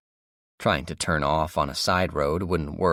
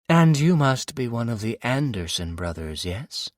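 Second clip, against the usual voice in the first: villainess; 6 semitones higher, 4 dB louder than usual. Acted. Villainess questions captive.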